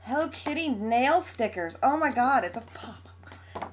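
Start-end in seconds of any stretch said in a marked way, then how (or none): 2.5-3.7 hushed, breath-only amazement; real whisper of delighted surprise; awed whisper. Real, not acted. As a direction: whisper it in wonder. Awed at opening the card.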